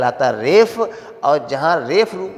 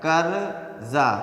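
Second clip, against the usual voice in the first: slowly drawn out; much slower than usual. Shown as a demonstration. Teacher to students, slowing the word down. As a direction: stretch कर्जा out very slowly. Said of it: "बहुत slow motion में धीरे-धीरे बोलेंगे".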